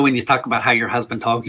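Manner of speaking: normal talking voice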